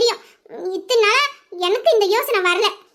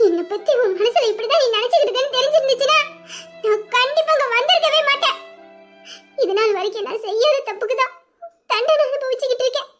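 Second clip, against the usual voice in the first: tearful pleading; 2 semitones higher than usual. Acted. Wife crying to her husband after he sends her away. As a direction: sob out how wronged she feels.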